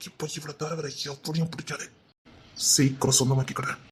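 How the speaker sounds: slow, soft murmur to himself; hushed, unhurried infatuation; smitten musing. Acted. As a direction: murmur it slowly, privately resolving to win her. Admirer musing to himself about her.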